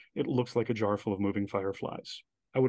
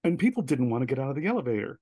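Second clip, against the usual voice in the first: ordinary talk, nothing marked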